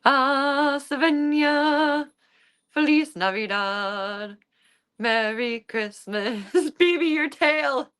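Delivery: stretched sing-song pet voice; cooing to cat. Real, not acted. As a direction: coo slowly at the kitten.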